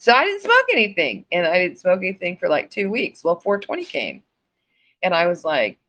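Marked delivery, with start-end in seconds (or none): none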